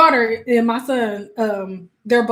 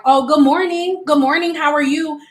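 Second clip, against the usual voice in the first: higher, chirpy mock greeting; friendly greeting; 5 semitones higher than usual. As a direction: greet someone cheerily, pitched up. Acted friendly greeter of the dad.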